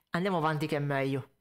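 ordinary delivery

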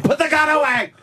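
high frantic yell; panicked shout. Acted. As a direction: scream it in mock panic. Mock panic, repeated louder.

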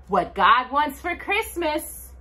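ordinary delivery

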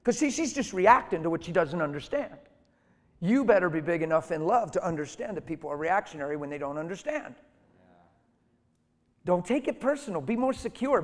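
loud, high, urgent sermon delivery; full-force preaching. Real, not acted. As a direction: preach it hard at the congregation.

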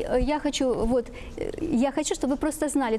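normal talking voice

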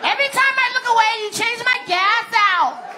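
very high, squeaky mock rage; mad wife impression. Wife ranting at husband about her changed tank, mock furious. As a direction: rant far up in pitch, stretching the last words. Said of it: "She comes in mad".

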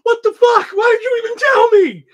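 high, loud, incredulous yell; hammed-up disbelief at the snub; exaggerated retold outburst; mock outrage. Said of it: "I'm like".

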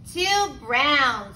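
plain reading voice

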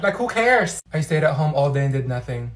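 ordinary talk, nothing marked

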